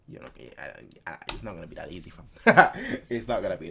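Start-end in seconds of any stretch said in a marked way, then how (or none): 0.1-2.3 muttered aside. Real, not acted. Muttering quietly to himself.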